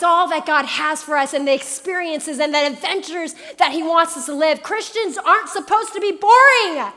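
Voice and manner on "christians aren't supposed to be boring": preaching exhortation. Acted. Rising exhortation.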